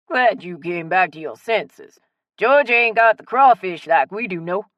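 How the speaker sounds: thick Cajun voice, playful and boastful; strong Cajun accent. A narrator's reading. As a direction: tease like a proud Cajun local. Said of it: "in his strong Cajun accent".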